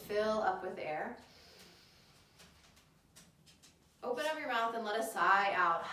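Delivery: calm them down into the breath; soothing yoga teacher. Acted.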